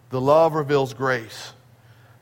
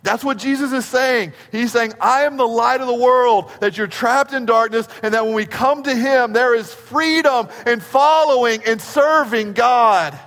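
higher, louder climactic preaching; full-force preaching; 6 semitones higher, 5 dB louder than usual. Real, not acted. Preacher proclaiming freedom to the congregation at full force. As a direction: shout the good news. Peak of the sermon.

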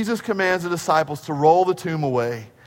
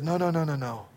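quick soft correction; 3 semitones lower, 9 dB quieter, faster than usual. Retold or imitated. Jesus gently correcting Martha.

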